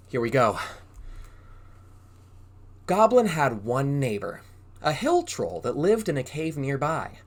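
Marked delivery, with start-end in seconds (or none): none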